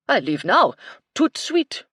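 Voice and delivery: loud, high, indignant French chef; French accent. A narrator's reading. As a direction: fling it at him in a huffy French accent. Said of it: "Michel's French accent".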